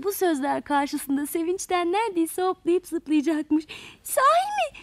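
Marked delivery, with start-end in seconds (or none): 4.0-4.8 much higher, delighted exclamation; little creature crying out in joy; joyful cry. A narrator's reading. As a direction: squeal with sudden joy. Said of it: "diye bağırmış".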